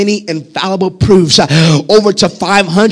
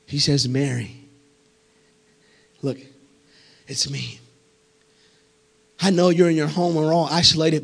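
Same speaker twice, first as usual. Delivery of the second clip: far softer and lower, almost confiding; hushed, intimate preaching; 7 semitones lower, 10 dB quieter than usual. Real, not acted. Preacher telling isolated viewers Jesus calls their name, hushed and tender. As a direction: lean into the mic and speak gently.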